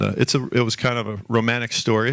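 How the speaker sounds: ordinary talk, nothing marked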